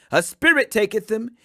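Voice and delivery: plain reading voice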